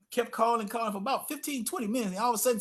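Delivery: normal talking voice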